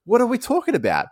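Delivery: high, loud, incredulous; exasperated. Real, not acted. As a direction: throw up your hands in disbelief. Exasperated rhetorical outburst.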